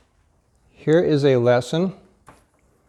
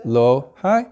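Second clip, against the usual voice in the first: low then high. Shown as a demonstration. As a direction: say low on a low pitch and high much higher. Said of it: "the tone went up on the end".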